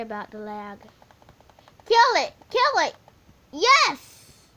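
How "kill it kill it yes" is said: high, loud excited yell; screaming. Real, not acted. Kid gamer screaming at the pig, real loud yell. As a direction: scream at the screen. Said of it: "I'm sorry for screaming".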